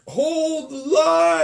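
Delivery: very slow, high booming call; booming drawn-out catchphrase. Retold or imitated. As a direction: stretch it out like a hammy announcer. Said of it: "he just waited forever".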